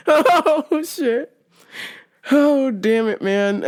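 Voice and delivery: catching your breath between real laughs; laughing recovery. Real, not acted. Storyteller recovering after the latch story, real laughing recovery. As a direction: sigh it out through leftover giggles.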